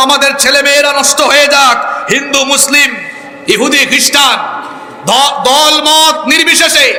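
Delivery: rally the whole crowd phrase by phrase; high, emphatic platform appeal; rallying preaching. Real, not acted. Rallies crowd in one demand.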